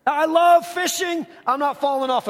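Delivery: "I love fishing" cried out high and loud, a bit slowed; exclaimed. Real, not acted. Pastor protesting to the congregation about the cliff, loud and high.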